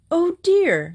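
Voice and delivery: high startled "oh dear"; dismayed. Acted. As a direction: gasp it out in dismay. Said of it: "in dismay".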